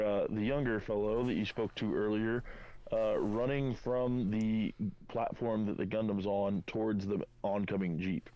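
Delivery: usual speaking voice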